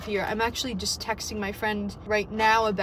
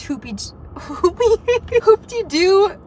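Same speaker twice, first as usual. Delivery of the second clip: excited cheer; 8 semitones higher, 6 dB louder, slower than usual. Real, not acted. Excited about Disney auditions.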